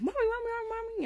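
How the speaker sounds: very high, loud imitated kids; panicked children calling. Daughters run downstairs to mother, calling in panic. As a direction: squeal it like kids rushing to mom.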